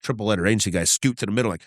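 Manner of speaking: ordinary delivery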